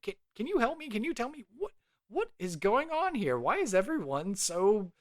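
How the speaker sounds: high-pitched, hesitant call over crowd noise; Duncan. Acted. Adventurer asks a stranger for help over the noise, high and flustered. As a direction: stammer out questions high, straining to be heard. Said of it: "over the noise, Duncan will be like".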